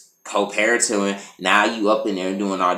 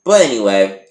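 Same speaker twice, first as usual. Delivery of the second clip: usual speaking voice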